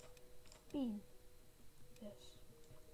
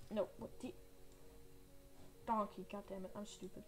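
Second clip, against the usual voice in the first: ordinary delivery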